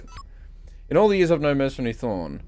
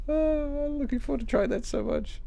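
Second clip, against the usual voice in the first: high, soft lovestruck sigh; dreamy longing; 14 semitones higher, 8 dB quieter than usual. Pretend. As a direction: swoon over it softly. Repeats dreamily after sigh.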